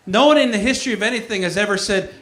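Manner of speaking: usual speaking voice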